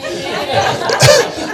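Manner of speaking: high, airless giggle; real laugh. Real, not acted. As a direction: let a laugh escape, high and light. Preacher laughs at own joke.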